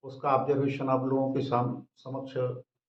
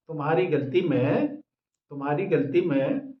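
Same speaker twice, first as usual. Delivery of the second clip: usual speaking voice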